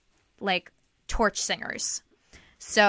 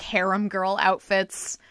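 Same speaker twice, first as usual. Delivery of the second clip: ordinary delivery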